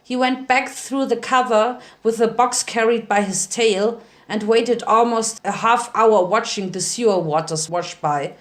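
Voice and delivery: ordinary delivery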